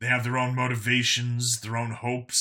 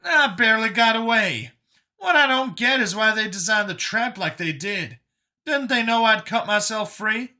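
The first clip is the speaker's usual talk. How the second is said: higher-pitched, animated voice for a story character; Brian's character voice; 10 semitones higher, 5 dB louder than usual. Acted. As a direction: voice Brian high and incredulous.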